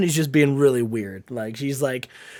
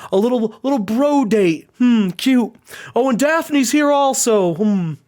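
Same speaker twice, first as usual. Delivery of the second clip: mocking taunt; 4 semitones higher than usual. Rival interrupting the tag team's dinner, condescending. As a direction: toy with them, stretching words mockingly.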